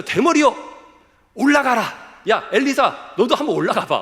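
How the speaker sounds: loud, high-pitched jeer; mocking taunt. Youths jeering at the prophet Elisha, loud mocking taunt. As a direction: shout it as a taunt to provoke him. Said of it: "이 뉘앙스가 뭐죠? 하나님을 조롱하는 거죠".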